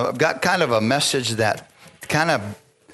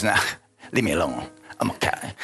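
dismissive brush-off; 7 semitones lower, 4 dB quieter than usual. Retold or imitated. Mimicking his dismissive old self.

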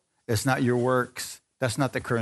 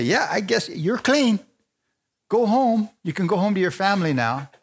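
high, raised voice sending him home; mock priest, higher and brighter; priest's reluctant release; 7 semitones higher than usual. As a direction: hand down the verdict with relief. Priest finally pronouncing clean.

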